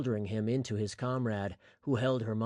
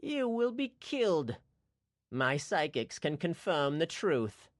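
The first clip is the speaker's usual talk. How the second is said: menacing villain; 5 semitones higher than usual. Acted. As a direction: draw out the threat. Towin threatens the cook.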